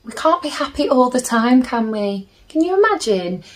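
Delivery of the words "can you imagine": ordinary delivery, nothing marked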